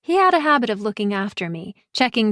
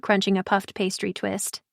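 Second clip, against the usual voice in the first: ordinary delivery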